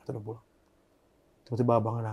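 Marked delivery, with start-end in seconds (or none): 0.0-0.5 much softer, heavy pause before; near-silent repeat. Real, not acted. Retelling his girlfriend's death to the host, hushed and grieving. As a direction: let it drop almost to nothing. Repeats that she has died.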